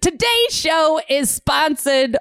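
ad read. Retold or imitated. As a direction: ham it up like a cheesy radio ad. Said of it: "So just a sponsorship".

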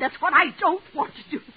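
ordinary delivery

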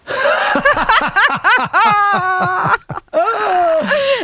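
big laugh at own joke; bursts out laughing, high. Real, not acted.

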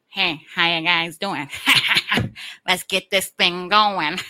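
slow, loud impression with big forced laughs; mock a loud boss, drawn out and guffawing; hammed-up laughing manager voice; imitating the manager. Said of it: "he talked like, uh, like this".

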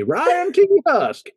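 high, showy name announcement; smooth con artist. Retold or imitated. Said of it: "you sound like a con man to me".

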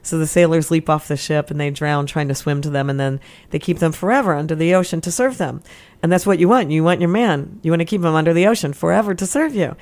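ordinary delivery

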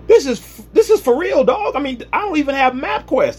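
high, astonished "this is for real"; amazed wise man. Acted. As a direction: gasp out the discovery in disbelief.

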